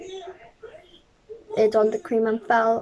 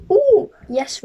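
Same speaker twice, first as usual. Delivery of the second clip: high, louder eager yes; 9 semitones higher, 7 dB louder than usual. Acted. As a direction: jump at the offer. Bear eagerly accepts.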